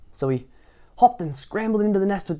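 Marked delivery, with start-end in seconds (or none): none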